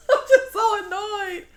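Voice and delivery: high, laughing I'm just so annoyed; giggling annoyed repeat. Real, not acted. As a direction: laugh it out in disbelief.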